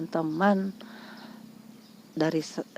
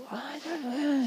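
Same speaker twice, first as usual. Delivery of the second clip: high, drawn-out imitated curse without much volume; mock cursing; 9 semitones higher, 5 dB quieter than usual. Caller swears at her again, mock fury. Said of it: "Dia maki gitu".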